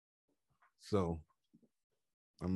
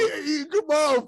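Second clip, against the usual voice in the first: laughing; 4 dB louder than usual. Real, not acted. Cracking up at the clip.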